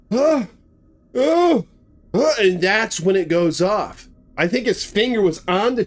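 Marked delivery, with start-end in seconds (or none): 0.1-2.3 frightened cries. Retold or imitated. Matthew terrified before shot.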